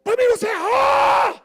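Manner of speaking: shouting arguer; mock furious shout. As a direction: explode with the accusation. Contrast: shouting the same line.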